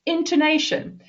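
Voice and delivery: usual speaking voice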